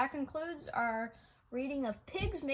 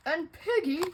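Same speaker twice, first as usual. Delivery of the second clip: plain reading voice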